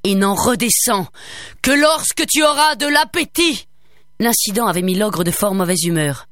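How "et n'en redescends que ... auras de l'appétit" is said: furious ogre; angry ogre. A narrator's reading. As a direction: bellow the command after her. Ogre still shouting.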